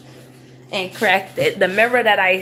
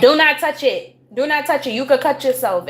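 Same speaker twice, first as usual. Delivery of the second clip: louder, higher parental command; stern warning; 5 semitones higher, 4 dB louder than usual. Real, not acted. Warning child off broken glass.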